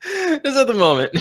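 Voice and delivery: high laughing tease. Real, not acted. Teasing the host about the fanfic, laughing. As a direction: giggle the words out, teasing.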